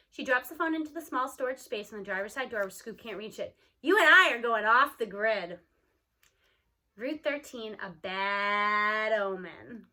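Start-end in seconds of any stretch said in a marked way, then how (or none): 3.8-5.6 loud, dark declaration; grandma's ominous off-the-grid line. Acted. Gma's ominous announcement. 7.0-9.9 slow, drawn-out bad omen; slow spooky narrator aside. A narrator's reading.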